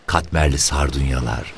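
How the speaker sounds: usual speaking voice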